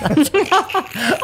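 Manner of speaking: high laughing yell; laughing shout. Real, not acted. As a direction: shout it while cracking up.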